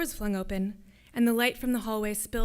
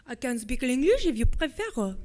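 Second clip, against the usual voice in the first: put-on heavily French-accented English, louder; very thick French accent; 2 semitones higher, 6 dB louder than usual. A narrator's reading. Soldier brightening, offers English, thick French accent. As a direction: make the English barely intelligible with French accent. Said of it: "With an accent so thick it was nearly impossible to make out his words".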